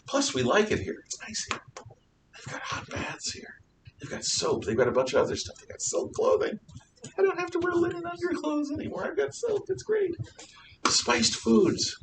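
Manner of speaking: soft, whispery voice of a contented Crusader; breath-only whisper, slipping into a squeak; hushed Crusader aside. Acted. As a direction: half-whisper the perks as if confiding them. Voices contented Crusaders listing comforts.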